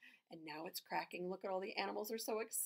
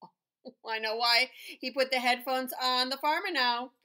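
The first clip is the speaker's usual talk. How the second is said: ordinary delivery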